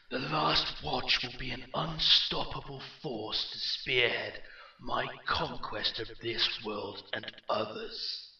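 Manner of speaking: echoing warlord, building from forceful to grand; rally the new army with swelling force; commanding villain, slowing on the final words; rising in intensity. Acted. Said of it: "his voice rising in intensity".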